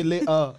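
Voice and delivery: "later" with the t dropped, lay-uh; British glottal stop. Retold or imitated. Host showing how the British say it, "later" with a glottal stop. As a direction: swallow the middle t of "later". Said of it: "the way they say later".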